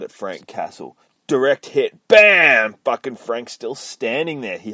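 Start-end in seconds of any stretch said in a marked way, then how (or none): 2.1-2.8 shouted impact noise; mouth-made impact; loud bam. Acted. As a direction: smack out a hit sound. Impact effect.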